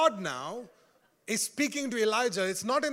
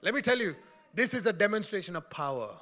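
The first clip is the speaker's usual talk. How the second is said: usual speaking voice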